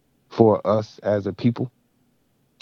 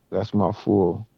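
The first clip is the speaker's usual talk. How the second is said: ordinary talk, nothing marked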